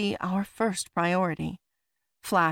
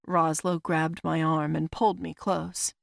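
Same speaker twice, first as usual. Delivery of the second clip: usual speaking voice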